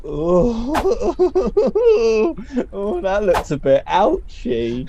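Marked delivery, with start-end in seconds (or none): 0.1-2.7 delighted laugh; short gleeful laugh. Acted. Laughs at injury.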